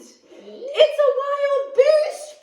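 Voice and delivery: slow, high, loud mock growl; hammed-up scared growl; Papa Bear finding Goldilocks, slow hammed-up growl. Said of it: "Papa Bear growled".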